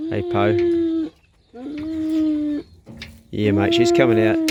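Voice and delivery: cooing to the dog as Poe comes out, squeaky and high; squeaky dog talk. Real, not acted. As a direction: coo at the pup in a tiny high voice.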